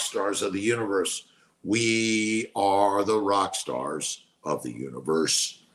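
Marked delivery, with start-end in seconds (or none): 1.6-5.6 slow, drawn-out affirmation. Real, not acted. Streamer repeating affirmation to viewers, slow and drawn out. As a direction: stretch out "We are", lower and slow.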